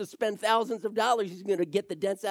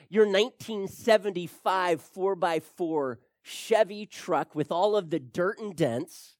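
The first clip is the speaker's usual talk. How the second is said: ordinary talk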